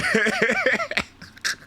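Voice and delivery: shrill wheezing giggle; high squeal laugh. Real, not acted. Streamer laughing at the pig, high squeal.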